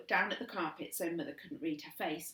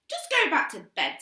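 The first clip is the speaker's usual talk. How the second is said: irritated; 9 semitones higher, 10 dB louder than usual. Acted. Sister snaps.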